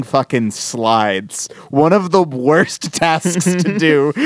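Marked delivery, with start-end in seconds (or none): 1.7-4.1 laughing through it. Real, not acted. Laughing about tedious slide mounting.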